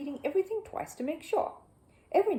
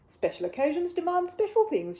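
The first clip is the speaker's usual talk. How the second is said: higher, louder proud voice; proud housekeeper; 8 semitones higher, 5 dB louder than usual. Acted. Missus Bird proud of her cake, raised. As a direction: announce it with pride.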